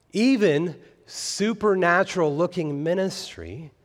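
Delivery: ordinary delivery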